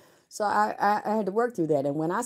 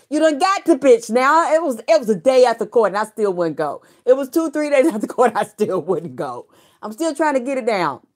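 loud, high, laughing brag; crowing about stubbornly refusing to go; excited; 7 semitones higher, 9 dB louder than usual. Real, not acted. To her viewers, crowing loudly about refusing the hospital.

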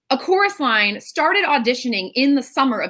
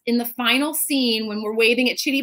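ordinary talk

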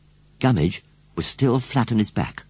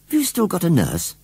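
put-on high-pitched boy's incredulous question; amazed; 11 semitones higher, 4 dB louder than usual. A narrator's reading. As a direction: ask it in astonishment. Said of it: "asked John in amazement".

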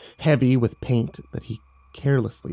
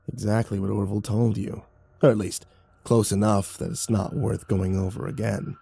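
raspy creature voice; 4 semitones lower, 4 dB quieter than usual. A narrator's reading. Ruck answers the bound narrator.

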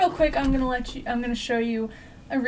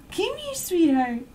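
higher, sing-song call to a pet; squeaky dog talk; 6 semitones higher than usual. Real, not acted. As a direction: coax the dog sweetly. Calling her dog.

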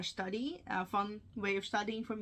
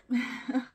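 short laugh; 5 semitones higher, 6 dB louder than usual. Real, not acted. Laughs mid-sentence at herself.

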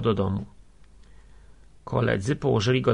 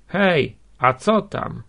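raised, higher hail; calling out; 5 semitones higher, 5 dB louder, slower than usual. A narrator's reading. Man in a window hailing the procession, calling out. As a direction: hail a group from a distance.

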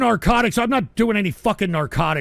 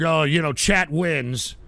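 ordinary talk

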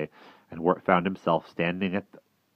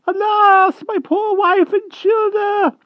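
very high, loud wailing hedgehog; 11 dB louder, slower than usual. A narrator's reading. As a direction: wail it out in despair. Hedgehog wails for family.

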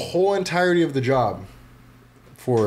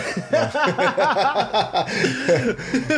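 long, high-pitched laugh; big drawn-out laughing fit; real laughter; 13 semitones higher than usual. Real, not acted. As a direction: burst out laughing at your own joke. Joke about cerebro, laughing.

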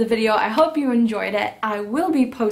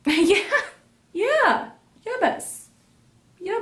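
high playful yeah; 10 semitones higher, slower than usual. Pretend.

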